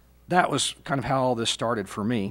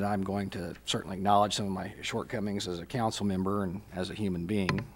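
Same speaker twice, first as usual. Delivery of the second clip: normal talking voice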